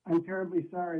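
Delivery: ordinary talk, nothing marked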